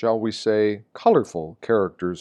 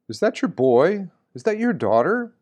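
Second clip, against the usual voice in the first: higher, inquisitive questions from strangers; curious onlookers asking; 9 semitones higher than usual. Retold or imitated. Bystanders at the mall quizzing him about the teen, higher and inquisitive. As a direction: mimic a nosy bystander's curious rising question.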